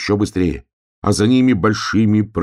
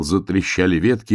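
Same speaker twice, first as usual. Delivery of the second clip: ordinary delivery, nothing marked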